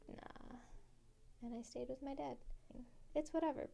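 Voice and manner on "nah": barely voiced, very soft; just breathe the refusal; whispered nah. Dad refusing flatly.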